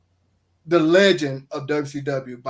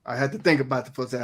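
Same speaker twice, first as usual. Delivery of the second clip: usual speaking voice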